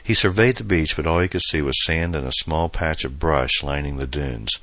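ordinary delivery, nothing marked

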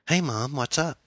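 put-on high, over-cheerful teenage daughter; a little too cheerful. A narrator's reading. Daughter greets mother on phone, a little too cheerful. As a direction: greet Mom just a touch too brightly. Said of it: "sounded a little too cheerful".

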